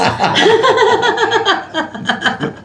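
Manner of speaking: long high-pitched laugh. Real, not acted. Laughing at the frown exercise.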